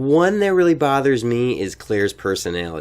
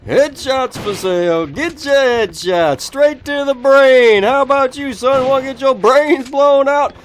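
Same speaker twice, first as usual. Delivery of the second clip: high, slow, loud hawker's pitch; mock street vendor; 9 semitones higher, 4 dB louder, slower than usual. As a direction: sell headshots like a carnival barker. Hawking headshots like a salesman.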